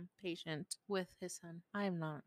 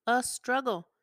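louder, punchy repeat; emphatic repeat; 3 semitones higher, 9 dB louder than usual. Real, not acted. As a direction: hit it hard the second time.

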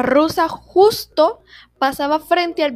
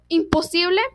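ordinary delivery

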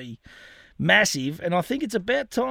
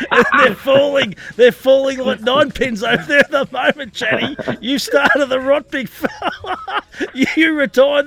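laughing ribbing; 8 semitones higher, 11 dB louder than usual. Real, not acted. Host ribs the guest, laughing.

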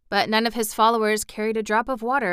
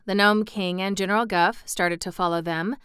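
ordinary delivery, nothing marked

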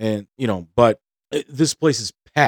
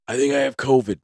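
lightly put-on feeble patient voice; a hint of a pretend-sick complaint; mock sick voice; 3 dB quieter than usual. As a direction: play a faker claiming to be ill. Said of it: "And they're pretty much just going".

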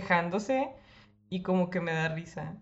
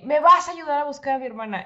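higher, louder angry demand; insist, fed up; 7 semitones higher, 7 dB louder than usual. Retold or imitated. To the guy at the club, angry demand. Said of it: "Le dije".

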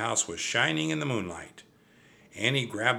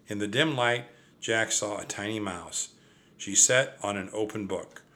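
ordinary delivery, nothing marked

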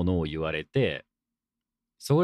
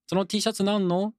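higher-pitched curious question; acted casual query, pitched up; curious questioner; 11 semitones higher than usual. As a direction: play someone asking about your shirt, voice lifted. Voices someone asking about the shirt.

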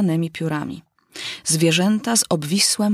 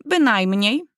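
a light snappy retort from the bird; curt parrot; 4 semitones higher, slower than usual. Acted. The parrot answers the mouse back, a light curt retort. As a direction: cut the mouse off short. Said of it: "odciął się ptak".

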